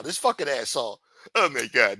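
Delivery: normal talking voice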